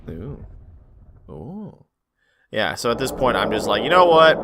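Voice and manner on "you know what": bold declaration. Real, not acted. Deciding to take a risk.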